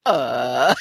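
stunned, choked little uh; a caught-off-guard grunt; startled uh. Retold or imitated. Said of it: "And Sue was like".

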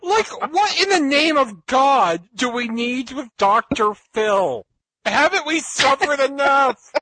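high, slow, mock-despairing rant; exasperated disbelief, half laughing. Real, not acted. Ranting about Doctor Phil, laughing.

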